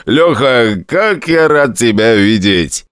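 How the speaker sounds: put-on overjoyed drunk greeting a friend. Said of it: "пьяным голосом".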